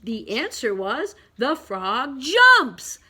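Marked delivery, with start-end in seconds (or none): 1.4-3.0 slow lead-in, loud JUMPS; loud exclamation. Shown as a demonstration. Teacher repeats the answer to the question, loud exclamation. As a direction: punch the final word out big. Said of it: "Big, loud exclamation point".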